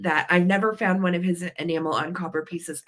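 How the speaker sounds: usual speaking voice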